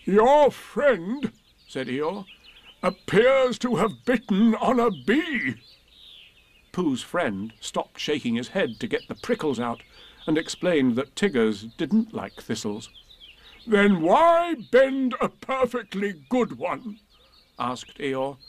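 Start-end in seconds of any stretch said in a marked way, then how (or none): 0.0-5.5 high, slow, mournful donkey drawl; Eeyore, politely gloomy and slow. A narrator's reading. Eeyore voiced in story. 13.6-17.0 high, slow, mournful donkey drawl; Eeyore, politely gloomy and slow. A narrator's reading. Eeyore voiced in story.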